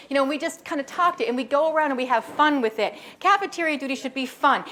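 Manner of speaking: usual speaking voice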